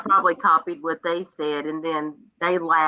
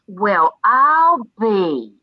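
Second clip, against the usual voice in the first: put-on old-lady sass; 4 semitones higher, 6 dB louder, much slower than usual. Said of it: "why do you sound like my mother".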